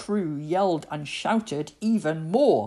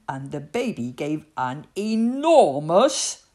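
ordinary delivery

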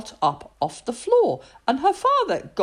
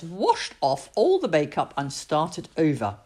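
ordinary delivery

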